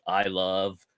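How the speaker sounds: ordinary talk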